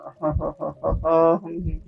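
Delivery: rippling ha-ha trailing into a chuckle; long easy chuckle; relaxed laugh. Real, not acted. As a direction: laugh easily, then let it fade. Laughs after complete relaxation.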